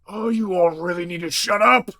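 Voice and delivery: gruff monster hissing a loud whisper-shout. Acted. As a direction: angrily shush them, half whisper, half shout. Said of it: "kind of whisper shout".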